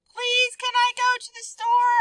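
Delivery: put-on high, loud pleading child; whiny high plea; begging. Shown as a demonstration. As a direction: beg like a kid wanting something. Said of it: "I begged".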